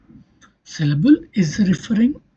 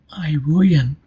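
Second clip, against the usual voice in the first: ordinary delivery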